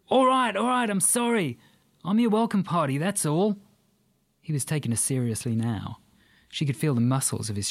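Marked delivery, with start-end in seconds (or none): 0.1-3.6 quickly giving in, Australian; panicked Australian. Acted. Aussie man backs down.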